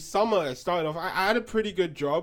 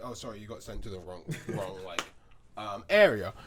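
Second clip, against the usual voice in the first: soft apology; 10 semitones lower, 5 dB quieter than usual. Retold or imitated. Apologizing to caller on phone.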